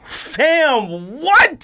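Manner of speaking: can't believe the price, shout it; squeaky shocked outburst; shocked disbelief. Real, not acted.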